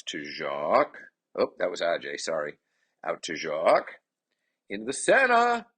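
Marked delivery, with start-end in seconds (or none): 4.7-5.6 loud, pitch leaping up; sportscaster's raised shout; excited call. Real, not acted. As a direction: call the scoring chance with rising excitement. Calling a chance at goal.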